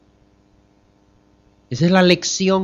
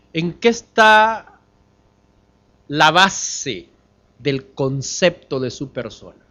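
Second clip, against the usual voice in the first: ordinary delivery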